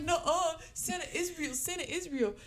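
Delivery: high, loud mock schoolkid denial; imitated childish chorus of protest; protesting kids. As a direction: insist like indignant little classmates. Classmates loudly contradict her.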